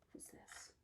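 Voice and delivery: hushed question; whisper. Real, not acted. Teacher whispering to children before the next animal. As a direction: whisper it like a secret.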